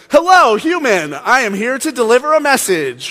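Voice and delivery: high, loud, stilted announcement; weirdo angel. Acted. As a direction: hail an earthling like an awkward alien messenger. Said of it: "he's just a weirdo".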